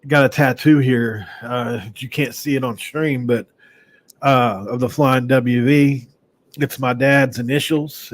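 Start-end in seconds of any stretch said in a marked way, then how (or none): none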